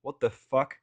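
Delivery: ordinary delivery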